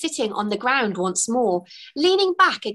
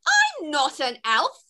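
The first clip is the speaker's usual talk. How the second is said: higher, slow, indignant retort with a laugh; offended retort; 6 semitones higher than usual. A narrator's reading. Fairy offended at being called an elf, retorting. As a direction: correct him, offended. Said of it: "retorted Twigs, offended".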